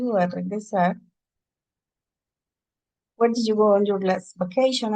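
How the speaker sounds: ordinary talk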